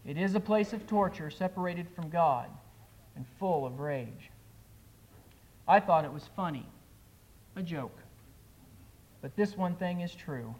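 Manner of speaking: a preacher reciting a poem with loud, pleading climaxes; dramatic poem recital. Acted. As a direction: perform the poem like a heartfelt altar call.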